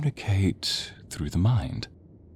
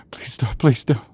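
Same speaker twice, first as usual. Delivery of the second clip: high, rapid begging, bursts of panic; frightened pleading; 7 semitones higher, 6 dB louder than usual. Acted. Villain begging to be spared, frightened pleading. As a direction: plead desperately, voice jumping up.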